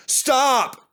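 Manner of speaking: loud, high desperate plea; dizzy diesel begging the twins to stop. A narrator's reading. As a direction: beg him to stop. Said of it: "he begged".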